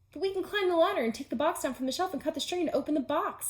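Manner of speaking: high, fast, breathless list; fast and eager. Acted. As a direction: rattle it off eagerly. Toad long rushed protest.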